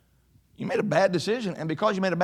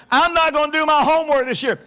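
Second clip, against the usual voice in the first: put-on louder, higher whiny kid voice; hammed-up lazy student, raised and pitched up; mock defiant student; 8 semitones higher, 6 dB louder than usual. Preacher mocking a student skipping homework, in a raised whiny voice.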